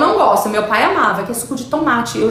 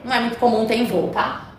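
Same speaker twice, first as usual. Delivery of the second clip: ordinary talk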